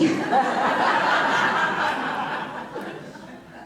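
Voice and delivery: long unvoiced laugh; long breathy laugh at a happy memory; breathy laughter. Real, not acted.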